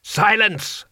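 high, sharp cry of command; screamed order. A narrator's reading. Chamberlain silencing Rincewind, high scream. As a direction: cut in with a high shout. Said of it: "screamed the Chamberlain".

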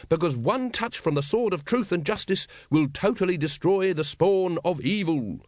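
high, grand proclamation slowing at the end; triumphant. A narrator's reading. Grand Master promising the dragon's destruction, triumphantly. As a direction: declaim it with a flourish. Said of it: "said the Supreme Grand Master triumphantly".

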